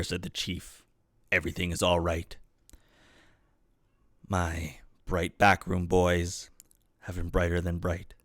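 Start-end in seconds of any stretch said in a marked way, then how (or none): 1.3-8.1 low, soft, measured confidence; calm reassurance. A narrator's reading. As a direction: lower your voice and reassure, unhurried. Chief calmly reassures, boasts of inventors.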